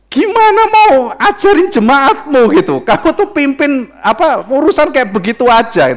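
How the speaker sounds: loud, high exasperated rebuke; mock scolding. Acted scolding of a leader. (omelan pura-pura bernada tinggi)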